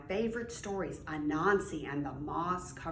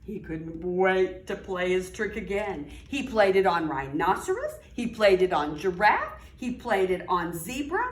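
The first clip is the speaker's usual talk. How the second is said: lively storybook read-aloud; reading to children; 6 dB louder than usual. A narrator's reading. Teacher reading aloud to young children, animated storytelling. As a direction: read it like story time for little kids.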